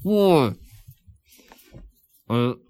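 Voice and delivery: slow, muffled counting through a stuffed mouth; mouth full of marshmallows. Real, not acted. Podcast host counting marshmallows into his mouth, muffled and mouth-full. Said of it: "jam some jet puffed mallows in my mouth".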